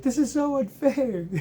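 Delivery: loud, very high indignant whine; hammed-up high-pitched wife outrage; imitating wife complaining. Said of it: "My wife's like".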